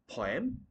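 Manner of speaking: poem as "POY-em", rising; mispronounced poem. Shown as a demonstration. Teacher acting the learner's mistake, poem as "poy-em". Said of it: "No, the word is going to be".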